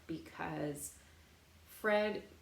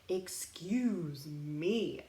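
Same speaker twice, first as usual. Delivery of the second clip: slow excuse me; much slower than usual. Acted. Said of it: "I say".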